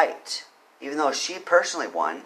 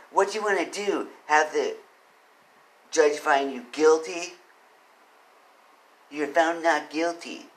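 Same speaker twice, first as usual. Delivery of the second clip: higher nasal sarcastic clerk imitation; 5 semitones higher than usual. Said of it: "sarcastic, and she had a nasally voice".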